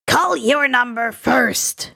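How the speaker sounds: lightly voiced, slow bratty comeback; childish retort. Tomura snapping back at Dabi, mildly childish. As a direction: fire it back like a sulky kid. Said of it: "Tomura shot back childishly".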